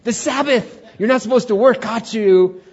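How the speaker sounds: high, loud triumphant accusation; hammed-up gotcha shout; mock Sabbath police. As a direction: catch the culprit with comic over-the-top outrage. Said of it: "And they're telling God in the flesh".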